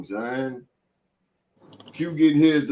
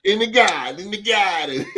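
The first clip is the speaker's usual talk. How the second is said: laughing mock Boston accent; 5 semitones higher, 4 dB louder than usual. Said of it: "They talk funny in Boston".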